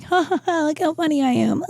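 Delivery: high, fake-bright attention-seeking voice; hammed-up look-at-me voice; mock show-off comic. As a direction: parody a needy comedian. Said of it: "we have to be like".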